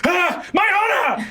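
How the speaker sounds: high, slow wail for lost honor; dismayed knight. Acted. The vegetable knight loses his pants, wailing high and slow. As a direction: cry out like a humiliated knight. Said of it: "Bonathan goes".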